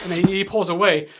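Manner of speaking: ordinary talk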